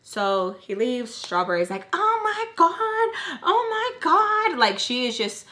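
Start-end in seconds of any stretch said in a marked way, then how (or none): 1.9-4.6 very high, slow, stretched-out swoon; imitating gushing friend. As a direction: draw out each word in a high mock squeal. Strawberry gushing once he leaves.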